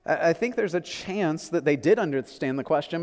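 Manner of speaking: normal talking voice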